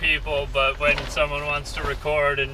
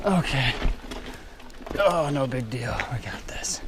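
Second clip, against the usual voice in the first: quiet whisper to self; 8 dB quieter than usual. Real, not acted. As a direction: breathe it as a whisper.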